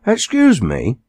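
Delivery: a touch of a plummy home counties drawl, politely superior; lightly voiced upper-class gentleman with a home counties drawl. A posh gentleman asking walkers the way, mild home counties drawl. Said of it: "he asked in a home counties drawl".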